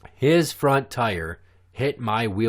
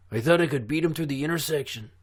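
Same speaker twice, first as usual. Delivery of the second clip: higher-pitched kid with a put-on Mexican accent; high, exaggerated Mexican accent; 6 semitones higher than usual. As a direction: imitate the kid in a thick mock Mexican accent. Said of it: "exactly how this little fucker sounded".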